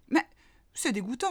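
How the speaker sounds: a little mole grumbling in disgust. A narrator's reading. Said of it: "rouspéta la petite taupe".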